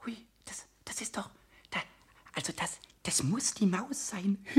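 very soft, halting amazement; breathless hush. Acted. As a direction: barely dare to breathe as you speak. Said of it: "wagte kaum zu atmen". (atemlos leise staunend)

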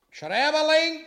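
high, loud summons across the hall; calling out. Acted. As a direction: bellow for the scribe. King summons the scribe.

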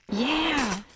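high, drawn-out yeah; excited cheer. A narrator's reading. Aton hears his longboard in the beat, cheering. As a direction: let the cheer swoop up happily.

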